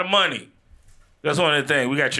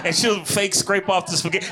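normal talking voice

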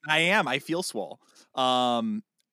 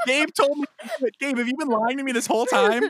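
high-pitched, playful outrage; hammed-up wounded accusation; mock indignation; 10 semitones higher, 4 dB louder than usual. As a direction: protest as if betrayed, half joking. Mock accusation after being teased.

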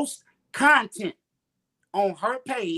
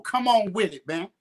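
usual speaking voice

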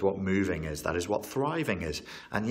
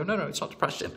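high, imitated insistent protest; mock denial; 9 semitones higher, much faster than usual. Client rejects therapist's suggestion, high mock protest. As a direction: mimic a client refusing the label, pitched right up.

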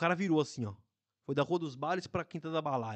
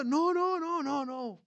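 high-pitched tourists saying no; a mocking imitation of the French passengers denying it; imitating tourists; 9 semitones higher than usual. (imitação aguda dos turistas franceses)

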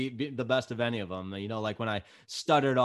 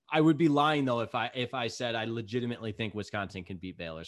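ordinary talk

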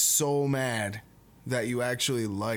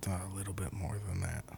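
fast, unvoiced whisper; 3 semitones lower, 7 dB quieter, faster than usual. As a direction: whisper the bad news quickly. Breaking news gently, secretively.

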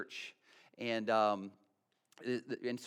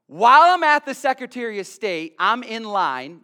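preaching; 6 semitones higher, 12 dB louder than usual. Real, not acted. Preaching build-up.

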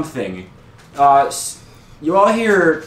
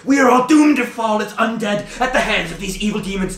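high, urgent prophetess voice; yelling doomsayer; 6 semitones higher than usual. Acted. Ragged woman tells the heroes of the curse, high frantic wailing. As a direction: plead like a frantic woman foretelling doom. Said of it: "she's yelling out, like".

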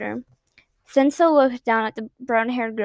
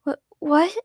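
higher, breaking question; stunned disbelief; 5 semitones higher than usual. A narrator's reading. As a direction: gasp it out in disbelief. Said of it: "asked quietly".

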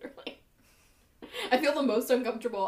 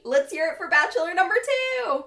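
pitched-up host announcement; game show host; 6 semitones higher than usual. Acted. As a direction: hype up the next bachelor.

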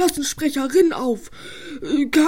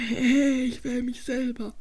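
lower, slow and much softer; sneaky aside; 5 semitones lower, 10 dB quieter than usual. Acted. Spike quietly admits his sneaky plan. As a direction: mutter the secret plan to yourself.

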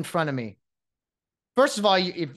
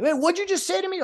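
high-pitched mock challenge; hammed-up indignant confrontation; affronted player; 13 semitones higher, faster than usual. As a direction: imitate a player taking offence.

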